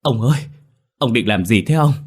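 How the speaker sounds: high, loud alarmed calling; panicked call. A narrator's reading. Wife calls frantically to her husband, panicked. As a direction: call out to stop him. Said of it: "hốt hoảng mà gọi với vào".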